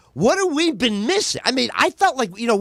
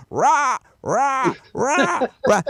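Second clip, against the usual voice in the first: parrot squawk; 5 semitones higher, much slower than usual. Pretend.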